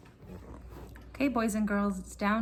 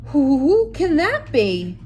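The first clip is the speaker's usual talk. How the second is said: loud, high, slow stammering alarm; startled fright; 5 semitones higher, 14 dB louder, slower than usual. Acted. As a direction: jump at the knock at night. Startled Bear at door knock.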